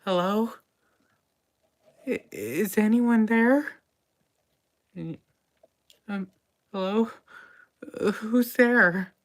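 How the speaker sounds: high, hesitant calls from under a table; nervous hidden voice. Acted. Person hiding under a table calls out, high and nervous. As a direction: timidly check if anyone is near. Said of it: "you hear".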